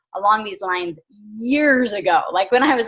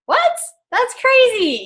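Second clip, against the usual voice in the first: much higher, a little louder, disbelieving; stunned by how good the quote is; amazed; 14 semitones higher, 4 dB louder, slower than usual. Real, not acted. Coach telling her team a quote blew her mind, amazed.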